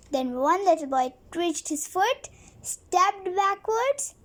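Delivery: ordinary delivery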